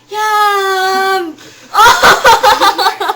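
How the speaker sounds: excited. Real, not acted. Excited tasting reaction, laugh.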